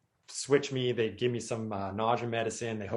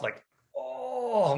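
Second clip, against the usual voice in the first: pained oh; 13 semitones higher than usual. Retold or imitated. Retelling sore shoulder pain.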